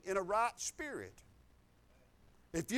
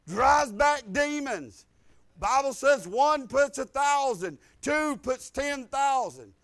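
loud, slow, hammered preaching; full-force preaching; 4 semitones higher, 8 dB louder, slower than usual. Acted. Preacher rousing the Wednesday night church, loud, slow declaiming. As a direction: drive each phrase home to the congregation.

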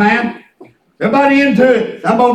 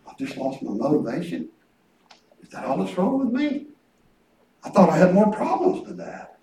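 softer, amazed inner thought; quiet realization; 5 semitones lower, 10 dB quieter than usual. Retold or imitated. Preacher recalling the moment he saw his problem, quiet. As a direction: say it under your breath in wonder.

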